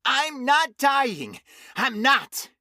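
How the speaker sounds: high, slow, desperate insistence; cling to denial; terrified man insisting he will live; raw denial. A narrator's reading. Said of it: "his voice suddenly raw".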